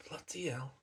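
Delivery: hushed unvoiced mutter to self; whispered aside. Real, not acted. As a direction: breathe it out under your breath. Aside after noticing own accent.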